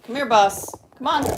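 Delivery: loud call across the room; loud pet call. Real, not acted. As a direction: call the cat over, loud. Calling the pet cat over.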